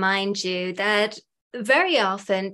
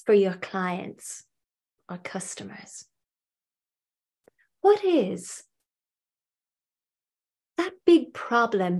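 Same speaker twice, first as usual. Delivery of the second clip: guided visualization. Acted. Hypnotherapist guiding listeners to picture their ideal clients, guided visualization. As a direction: lead the listener gradually inward, phrase by phrase, pausing long between.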